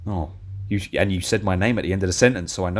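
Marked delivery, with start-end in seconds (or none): none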